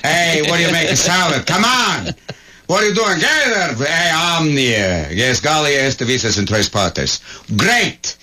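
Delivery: mock Roman emperor. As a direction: play a pompous Caesar barking orders. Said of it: "the Emperor of Rome is always".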